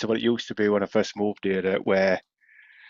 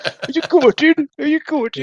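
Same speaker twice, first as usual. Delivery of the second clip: posh voice; 5 dB louder than usual. Retold or imitated. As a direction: ask it in a high posh voice. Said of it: "You only say it in a posh voice".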